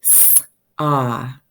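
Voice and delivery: s-ah, the ah sound drawn out; segmented word. Shown as a demonstration. Teacher stretching "S-ah" for the class. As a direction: sound out "S-ah" with the ah held. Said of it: "listen for the sound at the end".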